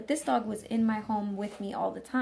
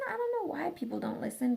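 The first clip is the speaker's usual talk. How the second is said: usual speaking voice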